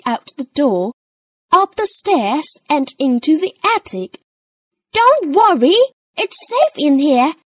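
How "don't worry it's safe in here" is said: put-on high little-girl voice, reassuring; Peppa reassuring. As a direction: soothe her brother. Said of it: "says Peppa".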